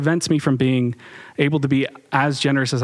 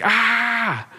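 imitating toddler scream; 10 semitones higher than usual. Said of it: "just screaming, like".

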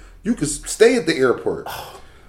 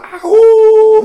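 long high howl, loud like a wolf; 10 dB louder than usual. Retold or imitated. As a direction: throw your head back and howl like the wolf. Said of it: "like, loud as shit".

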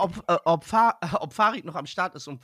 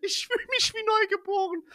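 cracking up mid-line; 13 semitones higher than usual. Real, not acted. After laughing fit, high voice.

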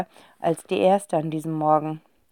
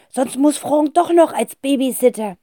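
higher urging wife voice; 7 semitones higher than usual. A narrator's reading. Wife urging her husband to confess, insistent. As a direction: press him quickly.

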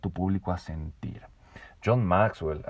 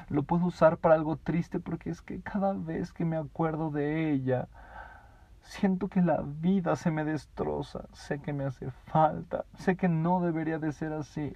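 mock sorrow; 7 semitones higher than usual. Shown as a demonstration. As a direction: sound heartbroken over a loss. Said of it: "Lo estoy exagerando".

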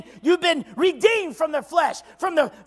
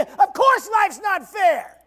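God's blunt answer; 5 semitones higher, 4 dB louder, slower than usual. Retold or imitated. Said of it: "He's gonna tell you".

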